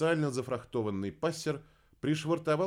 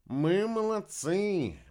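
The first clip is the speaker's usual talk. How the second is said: higher, louder, slow drawn-out repeat; dim echoing repeat; 10 semitones higher, 5 dB louder, much slower than usual. A narrator's reading. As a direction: echo the praise high and slow, pleased with himself. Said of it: "повторил Кайл". (медленно и выше, довольный повтор)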